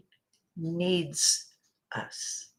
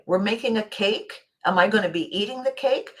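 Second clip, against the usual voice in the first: ordinary talk, nothing marked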